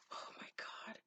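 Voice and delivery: breathless whisper of embarrassment; embarrassed whisper. Real, not acted. Vlogger cringing at her own cheesy line, whispered. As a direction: cringe at your own words under your breath. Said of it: "That sounds so cheesy".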